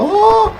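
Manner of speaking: sharp high startled yelp; a short high cry of surprise; sudden yelp. Real, not acted. Sudden cry during gameplay.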